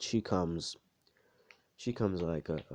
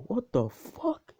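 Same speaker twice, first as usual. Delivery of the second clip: high, slow mock-shocked cry; stunned disbelief; 4 dB louder, much slower than usual. Onlooker seeing Izuku disappear, mock stunned. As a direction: stretch it out high in imitated amazement. Froppy sees Izuku vanish.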